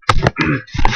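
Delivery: quick throat clear, losing voice. Real, not acted. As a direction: clear a tired, sore throat. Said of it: "Sorry, I'm starting to lose my voice".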